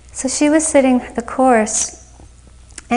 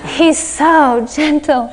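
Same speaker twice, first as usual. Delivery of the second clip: higher, louder, slow and gushing; swooning; 5 semitones higher, 7 dB louder, slower than usual. Retold or imitated. Nervous student leaving the interview, swooning happily. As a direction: sigh it out like she's in love. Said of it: "she was just swooning".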